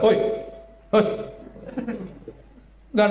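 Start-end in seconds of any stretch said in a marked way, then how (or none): 0.1-1.0 calling out hoi. Shown as a demonstration. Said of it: "Coba saya bilang".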